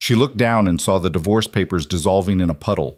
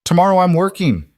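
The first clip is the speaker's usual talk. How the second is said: higher, louder, through clenched teeth; 10 semitones higher, 5 dB louder than usual. Acted. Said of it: "said through clenched teeth".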